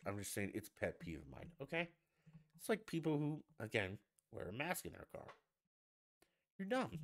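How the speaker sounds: usual speaking voice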